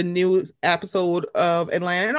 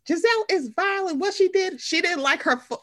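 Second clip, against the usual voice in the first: gasp of outrage; 8 semitones higher than usual. Real, not acted. Outraged retelling of season drama.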